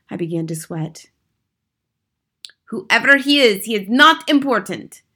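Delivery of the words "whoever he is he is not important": put-on high, loud accented witch pronouncement; announced. A narrator's reading. Grand High Witch dismissing the hidden boy, loud announcement. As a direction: dismiss him imperiously in the witch voice. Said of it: "announced the Grand High Witch".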